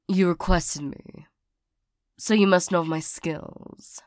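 ordinary talk

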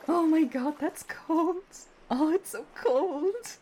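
high, loud shocked yelps; cold-water shock. Acted. Just hit freezing water with a friend, yelping high and loud. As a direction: cry out at the icy plunge.

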